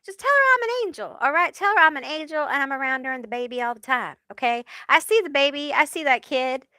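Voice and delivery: high, fast mock Italian accent. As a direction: do a quick Italian guy pleading. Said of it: "he's, like, Italian".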